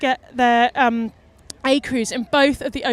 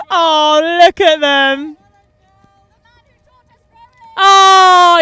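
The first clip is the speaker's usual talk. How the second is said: emotional; 4 semitones higher, 9 dB louder, much slower than usual. Real, not acted. Emotional seeing the family.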